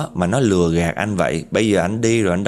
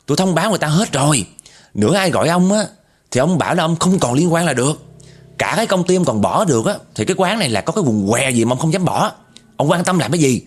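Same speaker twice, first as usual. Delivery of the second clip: yelling back; 6 semitones higher than usual. Retold or imitated. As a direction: shout him down, fast and high. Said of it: "nó hét lên luôn các bạn".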